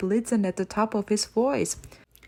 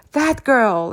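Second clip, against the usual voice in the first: louder outraged shout; 4 semitones higher, 6 dB louder than usual. Acted. Creeper fallen on his face, shouting about Amelia. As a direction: bark out the girl's name in fury. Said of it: "he shouted".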